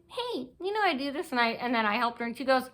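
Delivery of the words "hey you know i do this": high, soft put-on voice; mimicking her question. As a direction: ask it in a raised, fake little voice. Mimics the woman's question again.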